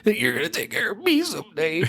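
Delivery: lightly voiced mother, high; a mom cooing to her child, lightly; a hint of a mom. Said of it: "but, like".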